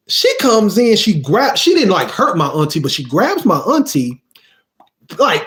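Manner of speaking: usual speaking voice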